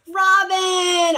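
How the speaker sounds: a high, loud greeting to a friend; excited call. Real, not acted. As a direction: call the name out happily. Calling out to a viewer.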